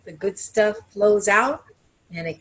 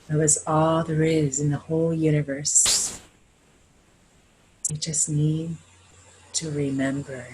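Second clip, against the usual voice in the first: blessing prayer; 2 semitones lower than usual. Acted. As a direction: bless the room gently, like a healer. Leads a closing blessing.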